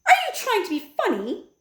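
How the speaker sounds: high, haughty wife's voice; Missus Jenkins. A narrator's reading. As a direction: put on a shrill-sounding posh wife. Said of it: "said Missus Jenkins".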